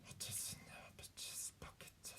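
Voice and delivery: very soft creature whisper; barely voiced, hissing whisper; Gollum. Shown as a demonstration. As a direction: whisper it like a creeping cave creature. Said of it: "Gollum is a little lower".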